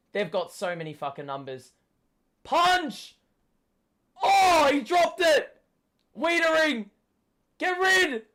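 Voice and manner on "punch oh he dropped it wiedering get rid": high, loud desperate shouts; frantic yelling. Real, not acted. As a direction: yell instructions at the players. Fan yelling during a scramble.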